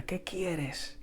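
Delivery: a furious woman, voice pinched and tight; enraged mother. A narrator's reading. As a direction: hostile question through the door.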